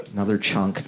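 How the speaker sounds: normal talking voice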